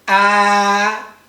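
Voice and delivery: sustained flat first-tone "ah", loud and even. Shown as a demonstration. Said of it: "is very flat".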